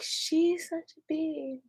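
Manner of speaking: high, soft, drawn-out mock gossip; catty aside. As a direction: draw it out softly, high and catty. Retold insult behind friend's back.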